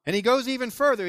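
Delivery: ordinary talk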